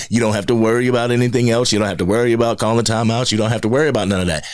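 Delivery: fast, run-together list of dismissals; rapid-fire reassurance. Pretend. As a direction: rattle it off quickly, brushing each worry aside. Imagined address to the new coordinator.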